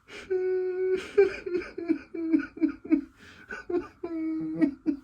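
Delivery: high wailing laugh-cry; laugh breaking into mock crying. Real, not acted. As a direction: laugh so hard it turns into a fake sob. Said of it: "I'm gonna cry right now".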